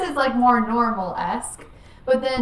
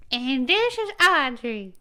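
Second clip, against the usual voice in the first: put-on slow Southern-accented intro; 3 semitones higher, 3 dB louder, slower than usual. Audrey introducing herself in her Southern voice. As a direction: drag it out, Southern style. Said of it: "So, here's mine".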